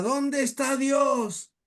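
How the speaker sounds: high, long-drawn pulpit cry; preacher's shout. Retold or imitated. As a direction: call it out slowly like a preacher. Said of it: "el padre grita".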